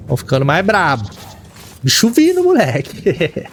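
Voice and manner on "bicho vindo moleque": sudden high cry of alarm; panicked yelp. Real, not acted. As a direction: shout a warning in panic. Inimigo chegando de surpresa. (grito de alarme em pânico)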